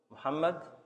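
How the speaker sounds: ordinary talk, nothing marked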